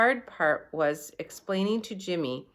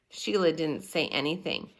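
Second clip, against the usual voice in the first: ordinary delivery, nothing marked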